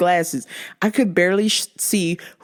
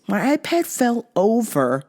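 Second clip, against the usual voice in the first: ordinary talk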